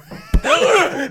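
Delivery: big shouted laugh, high; loud high laugh. Real, not acted. Loud laugh at his mother.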